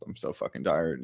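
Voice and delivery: a tired sigh of a line. Retold or imitated. Climbing the column at dawn, wiped-out mutter. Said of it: "you're like".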